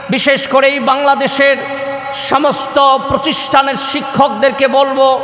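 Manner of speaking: high, level preacher's intonation; preaching appeal. Real, not acted. Preacher appeals to parents and teachers to teach manners, high preaching appeal.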